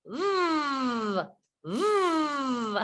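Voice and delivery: a buzzing voiced v; V sound. Shown as a demonstration. Teacher modelling the V sound for learners, buzzing. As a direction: buzz it on the lip. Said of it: "V says".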